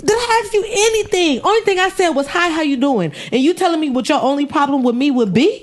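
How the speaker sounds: high, outraged mock reply; indignant outburst. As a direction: snap back in disbelief. Outraged retort, pitch very high.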